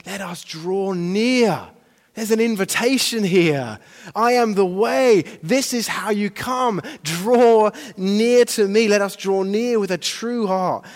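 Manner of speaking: rising, triumphant sermon delivery; fervent preaching. Real, not acted. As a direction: proclaim it to the whole congregation. Preaches scripture at full force.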